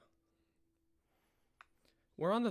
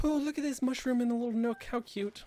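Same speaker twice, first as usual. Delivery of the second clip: cooing delight; 10 semitones higher, 6 dB louder than usual. Real, not acted. Cooing at cute mushroom.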